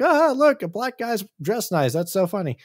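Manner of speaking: high-pitched mock amusement; mocking laughter. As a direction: imitate a jeering crowd, pitched high. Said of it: "everyone's laughing at him".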